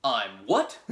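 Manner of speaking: sharp, loud "I'm what?"; offended disbelief, punched out; indignant surprise. Retold or imitated. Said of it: "he's just".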